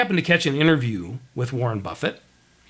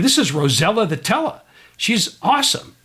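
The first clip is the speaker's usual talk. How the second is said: put-on Boston accent, enthusiastic; amateur Boston accent with proud lift; 6 semitones higher than usual. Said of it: "that's my best Boston accent".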